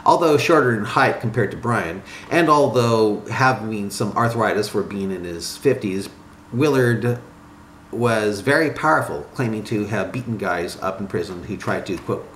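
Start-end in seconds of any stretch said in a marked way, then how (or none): none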